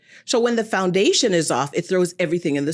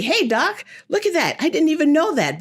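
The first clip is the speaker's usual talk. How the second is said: higher, eager amazed voice; excited patient; 8 semitones higher than usual. Retold or imitated. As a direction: lift it up, impressed and eager. Patient excited, quoted to doctor.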